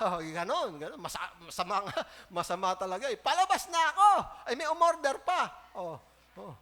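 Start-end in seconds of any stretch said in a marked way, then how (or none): 3.2-5.5 loud, high hammed-up complaint; mock angry rant. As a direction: play the irritated worker who wants to leave. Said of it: "galit na galit". (kunwaring galit na reklamo)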